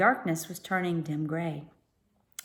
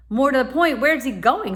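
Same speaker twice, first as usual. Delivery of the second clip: louder reply; 6 semitones higher, 7 dB louder than usual. A narrator's reading. Stella answering excitedly.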